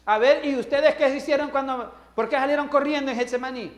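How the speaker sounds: loud, high mock reproach; mock scolding. Imagined Jesus scolding disciples.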